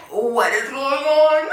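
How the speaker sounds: laughing, stunned reaction; mock disbelief with a giggle. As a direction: blurt it out through a laugh. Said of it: "I was just like".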